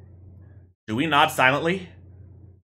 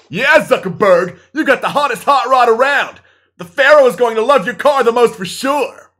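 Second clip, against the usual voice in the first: loud, high hype man; cheering buddy voice; 9 semitones higher, 8 dB louder than usual. Acted. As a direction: cheer him on hard. Another friend in the play.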